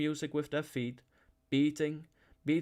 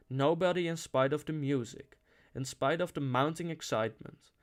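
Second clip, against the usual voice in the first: ordinary delivery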